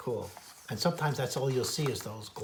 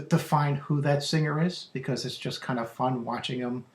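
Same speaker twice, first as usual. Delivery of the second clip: ordinary delivery, nothing marked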